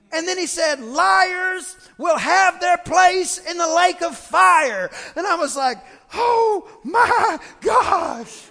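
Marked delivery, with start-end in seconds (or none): 0.8-4.9 high, loud, slow nasal hellfire warning; nasally preacher shout. Retold or imitated. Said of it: "he kinda had this nasally talk like this". 6.1-8.5 shocked gasp. Retold or imitated. Teen shocked at sermon.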